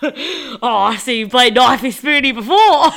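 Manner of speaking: loud laughing Aussie quote; playful broad Australian; mock Aussie sketch line. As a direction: ham up the Knifey Spoony line. Quoting Aussie comedy sketch.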